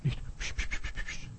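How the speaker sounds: tonloses, leises Pschen; zaghaftes Zischen. Shown as a demonstration. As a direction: verscheuch halbherzig nur flüsternd. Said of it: "du musst auftreten. Nicht psch". (schwaches, leises Zischen ohne Stimme)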